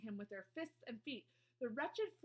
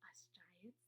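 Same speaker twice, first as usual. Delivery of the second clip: very soft, hushed whisper; 3 semitones lower, 18 dB quieter than usual. A narrator's reading. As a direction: drop right down to a whisper. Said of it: "the B F G whispered".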